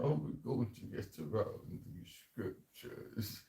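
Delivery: put-on slow, plummy posh English accent; mocking the elites in a posh English accent. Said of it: "in the English accent? Do you know what, um, the posh one?".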